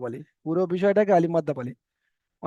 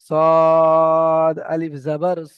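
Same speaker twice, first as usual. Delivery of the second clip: সদ আলিফ জাবার স spelled out very slowly and louder, every letter name drawn out; slow spelling demo; 6 dB louder, much slower than usual. Shown as a demonstration. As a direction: spell it out slowly and loudly for the class. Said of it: "আমি আপনাদেরকে দেখাচ্ছি".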